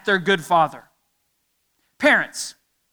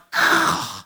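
put-on a breathy, put-upon huff of a sulky kid; kid's annoyed sniff. Shown as a demonstration. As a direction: huff like a kid told to do chores. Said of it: "a noise that comes out of their body".

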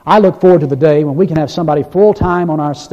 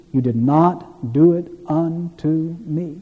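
slow, hushed emphasis; 6 dB quieter, much slower than usual. Acted. Slow, soft climax of reading.

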